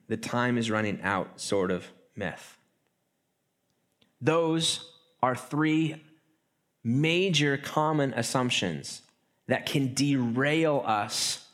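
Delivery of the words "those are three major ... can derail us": slow, weighted summing up; slow emphasis. Real, not acted. Preacher slowly sums up three points for the congregation. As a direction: lay each word down slowly. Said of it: "slow summing up of points".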